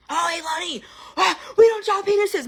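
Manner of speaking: high, loud scolding parent voice, hammed up; mocking a parent who shames her kid; mock shaming parent. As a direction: put on a prissy scold.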